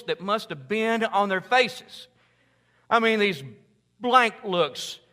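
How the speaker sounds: loud, high, driving sermon voice; impassioned pulpit build; full-force preaching. Acted. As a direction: preach it out to the back row.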